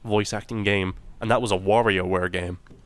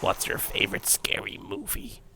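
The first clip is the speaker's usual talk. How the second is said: whispered horror-villain line; creepy whisper; 6 dB quieter than usual. Pretend. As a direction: breathe it like the Scream killer. Scream movie quote, whispered.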